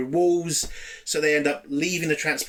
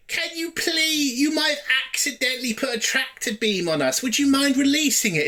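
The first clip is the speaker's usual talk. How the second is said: much higher, mock-polite pleading; meek pleading Pike; 9 semitones higher than usual. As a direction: plead like a spineless captain, pitched way up. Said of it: "the way Pike does it".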